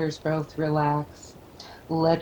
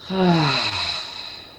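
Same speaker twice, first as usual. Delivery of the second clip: release sigh; 2 semitones lower than usual. Shown as a demonstration. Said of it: "Take a deep breath in and relax".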